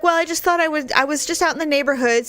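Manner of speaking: high-pitched, quick casual excuse; imitated mother's excuse. Mother explains dropping by unannounced, mimicked in high voice. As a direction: put the voice up and toss off the excuse.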